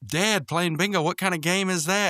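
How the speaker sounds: high, incredulous cartoon voice; skunk character voice. Voicing the skunk in recap.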